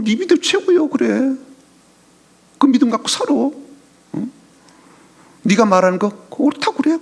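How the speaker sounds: high, sneering mock agreement; sarcastic mockery. Mocking scoffer dismisses a believer's faith, sneering high. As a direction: brush off the believer with a scornful, sing-song yes. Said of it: "이게 또 조롱하는 말이잖아요".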